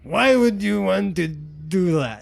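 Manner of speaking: put-on slurred drunk. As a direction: drag the words out like a Scottish drunk. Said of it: "just slurring all of his words".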